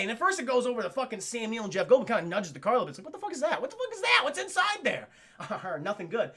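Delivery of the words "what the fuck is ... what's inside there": high-pitched, comic questioning; curious creature voice. Retold or imitated. Voicing the T-Rex nudging the car, comically curious. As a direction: squeak out nosy questions, hammed up.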